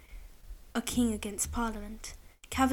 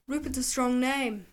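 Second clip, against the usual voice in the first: grinning. A narrator's reading. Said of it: "he grinned".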